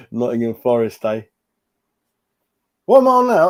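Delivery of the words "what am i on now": surprised. Real, not acted. Checking his count with chat.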